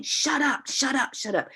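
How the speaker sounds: high-pitched mock yell, held back in volume; high, repeated retold outburst; imitated agitated outburst. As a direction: imitate someone telling voices to shut up. Imitates boy shouting at voices.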